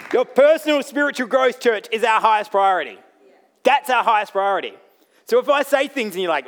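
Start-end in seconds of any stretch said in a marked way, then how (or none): 0.1-4.7 loud, forceful preaching declaration; rousing proclamation. Real, not acted. Preacher repeating the priority to the church, loud rallying cry. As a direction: proclaim it loudly to the whole room.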